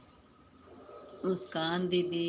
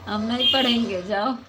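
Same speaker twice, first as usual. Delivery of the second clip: higher, much louder outburst; loud refusal; 4 semitones higher, 10 dB louder than usual. Real, not acted. Streamer dismissing viewers whose comments she won't read, loud refusal. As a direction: refuse loudly, pitch jumping up then falling.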